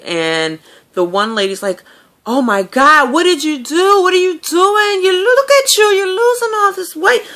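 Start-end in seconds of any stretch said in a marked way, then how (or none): 2.3-7.2 much higher and louder, gushing over her weight loss; gushing cafeteria lady. Retold or imitated. Cafeteria lady loudly gushing over a regular's weight loss. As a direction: squeal it out like a loud, delighted coworker. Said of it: "it was just, like, really loud".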